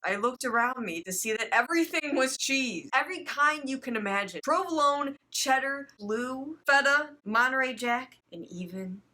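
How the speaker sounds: normal talking voice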